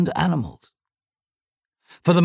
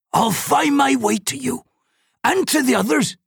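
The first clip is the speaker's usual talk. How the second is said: put-on high, earnest old man; 10 semitones higher than usual. A narrator's reading. Old man vows to brother.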